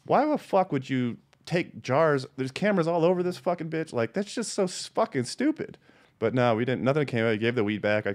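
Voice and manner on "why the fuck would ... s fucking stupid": climbing higher and higher in disbelief; mock outrage rising to a squeak; exasperated disbelief. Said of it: "I was just like".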